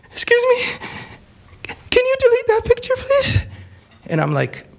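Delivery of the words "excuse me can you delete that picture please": much higher, meek pleading voice; timid girl. Retold or imitated. Hijabi girl begs speaker to delete photo, high meek plea. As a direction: ask sweetly, all the fight gone. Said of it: "The hijabi girl that was just screaming".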